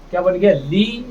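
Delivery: normal talking voice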